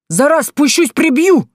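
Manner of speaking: shrill shouted threat; yelling threat. Acted. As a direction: scream the threat down at him. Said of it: "заорала с дерева".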